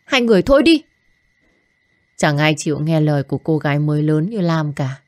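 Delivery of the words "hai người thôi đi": higher, louder shout to stop them; yelled plea. Acted. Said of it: "gào thét và can ngăn". (hét lên can ngăn)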